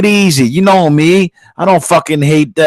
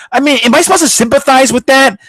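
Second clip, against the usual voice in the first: ordinary talk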